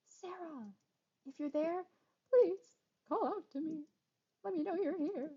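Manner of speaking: high calling voice. Acted. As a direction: call out through the house. Calling out through the house.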